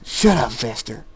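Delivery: loud burst of anger; angry shout. A narrator's reading. Jacob facing David to silence him, angry shout. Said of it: "and he screams".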